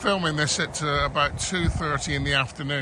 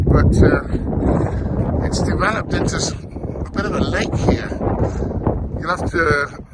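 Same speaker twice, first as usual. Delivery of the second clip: wind on mic; 2 semitones higher, 5 dB louder than usual. Real, not acted. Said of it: "excuse the wind noise on this video".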